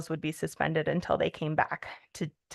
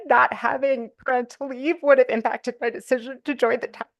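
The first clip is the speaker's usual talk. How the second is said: real choked-up voice, pitched higher; getting emotional; 6 semitones higher, 3 dB louder than usual. Real, not acted. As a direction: push on through welling tears, voice climbing. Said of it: "I'm getting emotional".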